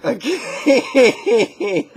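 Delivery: high, loud laughing okay. Real, not acted. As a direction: laugh through the word. Laughing at own bit.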